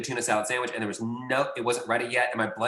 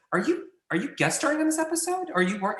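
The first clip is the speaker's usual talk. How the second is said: high awkward question. Retold or imitated.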